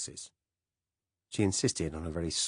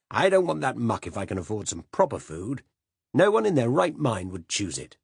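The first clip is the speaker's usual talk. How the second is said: louder, higher indignant grumble; indignant rant; 5 semitones higher, 5 dB louder than usual. Acted. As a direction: rail against fancy food. Silas ranting about French food.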